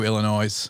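Illinois with the final S sounded as a Z; mispronounced Illinois. Shown as a demonstration. Joking about small-town locals, Illinois with its S sounded. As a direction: say Illinois ending in "noyz". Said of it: "they pronounce the S in Illinois".

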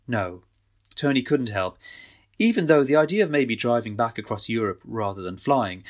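ordinary delivery

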